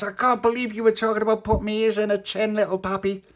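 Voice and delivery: high, loud complaint; indignant reproach. Acted. Tony to Little Pappy about his ears, indignant reproach. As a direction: complain in outraged disbelief.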